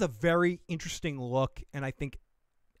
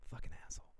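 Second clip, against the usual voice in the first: muttered insult; 16 dB quieter, much faster than usual. Pretend. Girl's voiced rejection.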